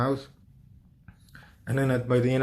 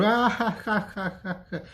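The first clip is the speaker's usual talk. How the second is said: cartoon demon cackle; 9 semitones higher than usual. Demons mocking me as I wake, high cartoon cackle. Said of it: "they sounded like fucking cartoons".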